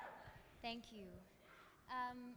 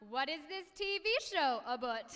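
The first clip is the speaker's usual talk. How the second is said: put-on Canadian accent; 7 dB louder than usual. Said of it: "They also say such things as".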